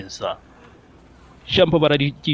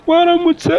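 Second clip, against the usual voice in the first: put-on much higher, childlike greeting; little girl voice; 13 semitones higher, 3 dB louder, slower than usual. Imitating a little girl's greeting.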